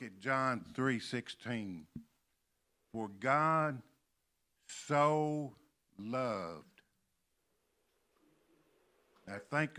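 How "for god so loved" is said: pause, then lift and stretch "so"; stress on SO. Shown as a demonstration. Preacher reading John three sixteen, stress on SO. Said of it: "When they put that word so".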